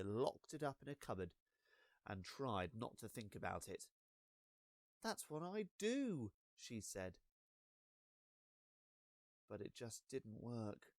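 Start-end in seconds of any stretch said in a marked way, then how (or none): 5.0-6.3 chirpy friend. Acted. Best friend voiced.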